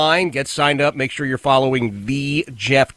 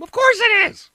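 indignant retort. Real, not acted. Rebutting the CNN pinhead.

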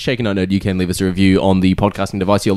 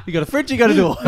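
laughing; 6 semitones higher, faster than usual. Real, not acted. Laughing through the joke.

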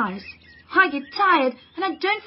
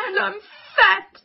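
crying; 5 semitones higher than usual. Acted. Wanda cries about teasing.

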